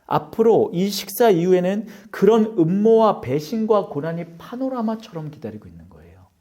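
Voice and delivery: ordinary talk, nothing marked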